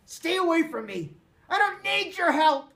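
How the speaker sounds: high furious scream; shrill pushed-away fury at full volume. A narrator's reading. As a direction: scream him away in a rage. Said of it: "he screamed".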